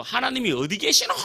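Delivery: sudden high outburst in Busan dialect; Busan dialect yell. Retold or imitated. Drinking uncle rejecting talk of seminary, yelling in Busan dialect. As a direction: scoff loudly with a Busan accent. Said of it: "갑자기 부산 사투리로".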